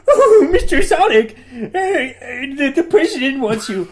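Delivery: put-on high, loud laughing old creature; old owl voice. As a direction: cackle it as a frantic old owl. Voicing old owl character.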